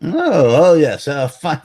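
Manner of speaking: normal talking voice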